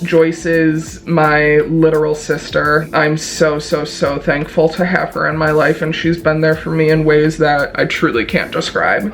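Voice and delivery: ordinary talk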